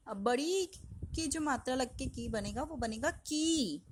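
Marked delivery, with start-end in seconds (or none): 3.1-3.8 की said slowly, long ई with a bit of weight; drawn out with stress. Shown as a demonstration. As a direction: stretch की and press it slightly. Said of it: "उस पे हम थोड़ा सा जोर देंगे". (की को खींचकर, हल्के ज़ोर से)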